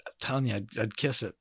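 low, soft confession; quiet. Real, not acted. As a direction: say it under your breath.

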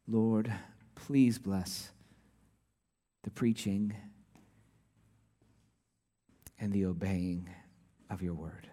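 soft, hushed prayer fading away; quiet pulpit prayer. Real, not acted. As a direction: pray gently, almost under the breath. Short prayer before the sermon.

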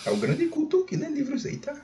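voz fina e zombeteira; mock solene. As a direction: debochando do leitor culto. Zoa o colega leitor. (tom de gozação exagerada)

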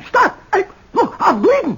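high, frantic pleading; panicked cry. Acted. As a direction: panic as the blade cuts. Cut by razor, panicking.

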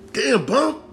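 very high, rising disbelief; astonished squeal at a reckless pal; incredulous exclamation. Pretend. Fan to Bumpy over his new beef, incredulous and high.

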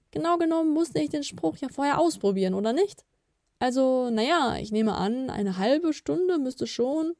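high-pitched, embarrassed excuse; sheepish. A narrator's reading. Nip admitting he used some potion, sheepish. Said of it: "sagte Nip betreten".

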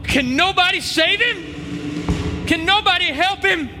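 soaring, high shouted preaching refrain; preaching at full force. Acted. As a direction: build the congregation up to Sunday's victory, shouting. Preaching climax, Friday/Sunday refrain.

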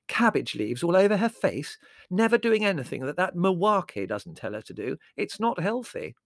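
high, emphatic tirade; scandalised relative; indignant disapproving woman. Acted. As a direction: list the offences in outrage.

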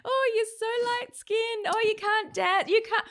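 high, loud mock voice of teasing Kenyans; mocking the locals. Imitating relatives in Kenya teasing her, high and loud. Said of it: "Everyone's like".

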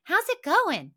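high, sugary hi hun; sweet wife voice. Retold or imitated. Wife greeting her writing husband, high sugary sweetness. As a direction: chirp it high like a doting wife. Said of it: "She's like".

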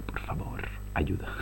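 whispered fake plea for help; mock plea. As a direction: beg in a tiny joking whisper. (suplicando en broma con un susurro)

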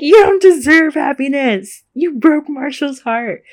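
high, loud mock scolding. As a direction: tell him off, hammed up. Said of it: "I would be like".

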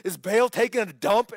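mocking taunt. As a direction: jeer at them like a schoolyard bully. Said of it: "Elijah's mocking them".

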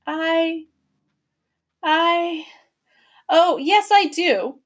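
high, halting brave answer; bravely, close to tears. A narrator's reading. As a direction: push the words out through fear. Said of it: "Lindy replied bravely".

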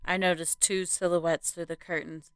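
plain reading voice

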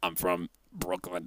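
a proud Brooklynite showing off; Brooklyn accent. Retold or imitated. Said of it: "you sound like you're from the Northeast".

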